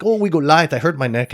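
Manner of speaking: mock-innocent plea in a Brazilian accent. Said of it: "your typical Brazilian guy".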